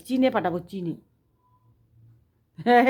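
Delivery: usual speaking voice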